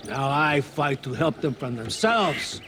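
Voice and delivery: normal talking voice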